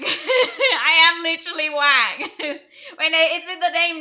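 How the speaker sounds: laughing through the words. Real, not acted. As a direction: giggle while you say it. Laughing at own meme name.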